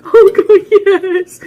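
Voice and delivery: high, loud, strained mock wail; hammed-up terrified wail. Mocking a frightened guest answering the host, high wailing. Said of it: "she's like".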